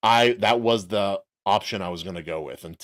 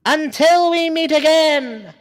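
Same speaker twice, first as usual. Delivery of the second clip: villain farewell; 7 dB louder, slower than usual. Acted. Quoting a villain line.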